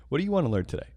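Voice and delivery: lightly voiced clueless teacher; retold teacher. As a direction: mimic a teacher's casual question. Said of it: "and they go".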